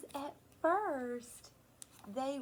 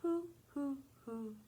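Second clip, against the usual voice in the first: owl hoots. Retold or imitated. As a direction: hoot gently like an owl at night. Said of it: "The owls in the forest say".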